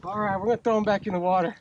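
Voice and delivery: ordinary delivery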